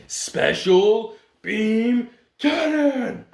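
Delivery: dramatic anime attack callout. Acted. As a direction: stretch out the attack name like an anime hero. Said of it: "Time to show him the technique".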